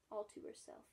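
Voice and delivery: ordinary delivery, nothing marked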